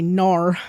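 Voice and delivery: loud Australian-style "nar"; "nar" called out with an exaggerated Australian vowel; Aussie "nar". Shown as a demonstration. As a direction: say "nar" as a broad mock-Aussie no. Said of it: "All I know is how to say".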